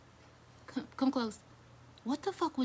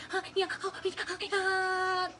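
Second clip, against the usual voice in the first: mock moaning; 7 semitones higher than usual. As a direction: moan it out lightly, half-hearted. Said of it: "I was like".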